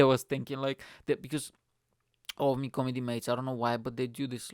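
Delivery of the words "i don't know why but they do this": ordinary talk, nothing marked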